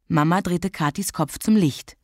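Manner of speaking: ordinary delivery, nothing marked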